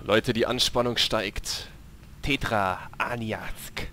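dramatic announcer. Pretend. Narrating boss intro dramatically.

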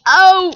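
loud, high "oh"; startled yell. Real, not acted. Gamer who just missed, startled yell. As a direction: yelp at the slip.

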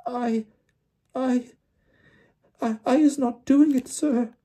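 very high, squeaky frightened elf; squeaky, halting protest; Winky gasping. A narrator's reading. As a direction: stammer it out in a tiny high squeak. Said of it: "Winky gasped".

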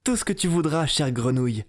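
put-on high eager young princess. Princess eagerly promising the frog, high voice. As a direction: promise everything in a rush of hope. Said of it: "lui dit la fille du roi".

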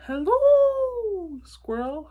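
high, drawn-out owl hoot. A narrator's reading. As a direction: stretch hello into a loud, high hoot. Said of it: "hooted Owl".

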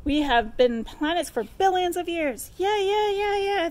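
backing chorus of moons; moons yelling. Acted. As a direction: cheer him on like a gang. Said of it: "The moons yell".

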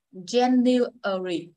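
January very slowly, stretched syllable by syllable; January in a slow, careful teaching version; slow demonstration. Shown as a demonstration. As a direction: draw out every syllable of January. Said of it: "Cô đọc chậm nha".

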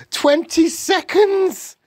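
very high, loud, stretched shriek; witch crying out. A narrator's reading. Witch counting down to the transformation, crying out. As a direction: screech the countdown slowly. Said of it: "cried the Grand High Witch".

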